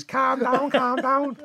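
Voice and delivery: high mock Scouse accent; Harry Enfield's Scousers. Mocking the friend's choice of words, in high Scouse accent. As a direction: mimic his bad Scouse in a high voice. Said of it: "the one thing you come out with is".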